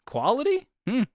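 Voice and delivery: high rising skeptical "Quality?"; doubtful question. Acted. Questions own word, mock doubt.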